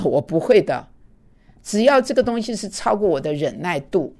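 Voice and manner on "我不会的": usual speaking voice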